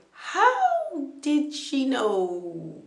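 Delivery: slow, drawn-out astonished question; slow stunned disbelief; amazed wonder. Retold or imitated. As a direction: let the wonder stretch out. Wondering how she knew.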